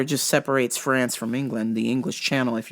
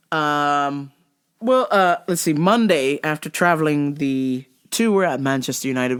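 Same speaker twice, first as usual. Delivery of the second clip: ordinary talk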